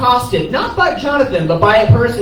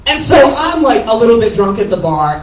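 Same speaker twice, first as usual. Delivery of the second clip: ordinary talk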